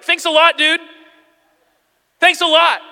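loud, high-pitched mock complaint; indignant retold outburst; acted annoyed brother. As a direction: throw it out as an aggrieved sibling. Brother confronts him in story.

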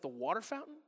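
put-on a kid quietly confused by the word; understated puzzled boy. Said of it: "And he's like".

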